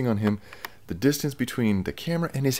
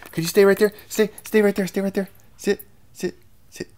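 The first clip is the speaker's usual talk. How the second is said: much higher, louder dog commands; commanding a dog; 11 semitones higher, 8 dB louder than usual. Real, not acted. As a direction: bark out stay and sit.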